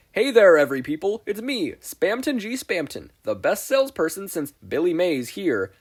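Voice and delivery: high, loud huckster pitch; glitchy pitchman announcing himself; Spamton salesman voice. Acted.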